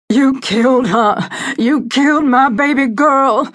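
a grieving father howling accusation; anguished shouts. A narrator's reading. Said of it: "The anguished shouts".

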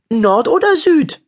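put-on boy chewing, bored by the news. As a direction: ask as if it hardly matters. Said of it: "fragte Finn ... gelangweilt".

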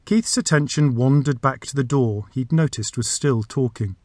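plain reading voice

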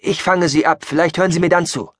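high, hurried hopeful plan; urgent improvising. Acted. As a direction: blurt out the plan as it forms. (hastig und hoch, drängend)

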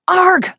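high, loud groan of frustration; frustrated cry. A narrator's reading. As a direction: snarl out your annoyance. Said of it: "I cried".

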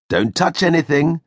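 parting caution to the puppet; Geppetto warning. A narrator's reading. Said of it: "he said before he left".

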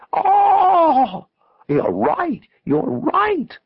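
very high, loud squawk of revelation; shrieking, high-pitched delight; crow-like squawk. A narrator's reading. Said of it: "Adrian let out a crow-like squawk".

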